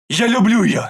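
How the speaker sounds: high, loud cry of love; shouted confession. Acted. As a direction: blurt it out defiantly. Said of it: "Выкрикиваю". (выкрикнутое признание)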